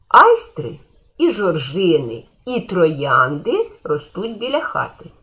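slow, clear reading of the example sentence. Shown as a demonstration. Teacher reads the example to pupils, slowly and clearly. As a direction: dictate it slowly, word by word for the class. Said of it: "Наприклад".

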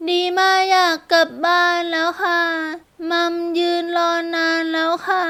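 put-on high-pitched small child voice; little girl. A narrator's reading. Girl asking to go home to mum, child voice. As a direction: ask politely as a small child.

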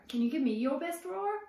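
ordinary delivery

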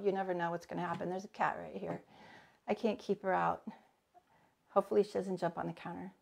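normal talking voice

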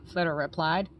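ordinary delivery, nothing marked

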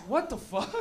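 usual speaking voice

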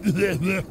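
mock hacking, in short bursts; pretend hacking cough. Shown as a demonstration. Describing spring allergies, pretend hacking cough. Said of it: "just pretending to cough".

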